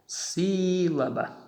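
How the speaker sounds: teacher slowly marking the stress in Sílaba; stress shown. Shown as a demonstration. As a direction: draw out SÍ-laba to show the stress. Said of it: "aqui está a tonicidade".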